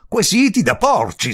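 high, loud, scandalized "Quesiti da porci"; ranting. A narrator's reading. Visitor quoting the countess's phrase at her, ranting. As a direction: bellow "Quesiti da porci" in outrage. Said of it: "strepitò il visitatore".

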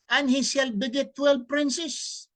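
slow, loud, solemn Bible reading; scripture reading. Acted. Preacher reading the Bible verse aloud to listeners, solemn declaiming. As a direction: proclaim each verse slowly to the audience.

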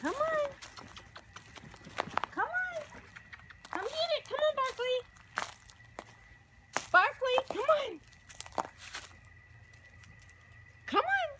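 sing-song high call to the dogs. Real, not acted. Owner calling her dogs to come see, high and sing-song. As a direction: coax the dogs over in a high voice.

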